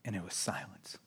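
hushed whisper into stillness. Acted. Pastor describing the silent tomb, whispered.

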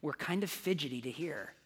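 soft, hushed prayer voice. Real, not acted. Pastor praying softly over the congregation, hushed. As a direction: pray gently over the people.

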